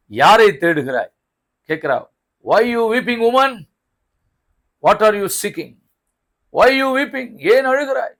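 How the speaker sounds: loud, ringing scripture proclamation; impassioned preaching. Real, not acted. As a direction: declaim it forcefully to the congregation. Preaches at full force.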